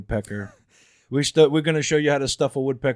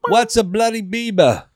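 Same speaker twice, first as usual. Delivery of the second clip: higher, slower, louder British mumble; lazy English rocker drawl; 8 semitones higher, 6 dB louder, slower than usual. Retold or imitated. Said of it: "Is that an [name] impression?".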